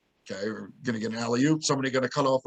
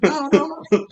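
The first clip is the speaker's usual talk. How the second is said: high, louder mock yell; pitched-up retold protest; imitated yell; 13 semitones higher, 6 dB louder, slower than usual. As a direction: mimic someone frantically calling off a shot. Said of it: "somebody yelled".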